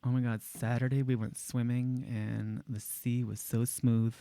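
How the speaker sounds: "Saturday", "swimming", "sea" softly, with sharp sibilant S; soft, hammed-up S on "so smooth"; tip-of-the-tongue S. Shown as a demonstration. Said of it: "just at the tip of the tongue".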